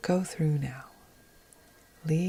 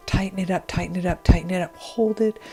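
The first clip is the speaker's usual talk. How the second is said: higher, louder, insistent repeated push; pressing, raised-voice coaching burst; urgent tensing cue; 3 semitones higher, 8 dB louder than usual. Shown as a demonstration. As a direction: urge them to squeeze and hold. Coaching listeners to tense up.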